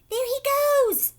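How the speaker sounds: very high, loud slow shout. A narrator's reading. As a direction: point and shout. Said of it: "someone shouted".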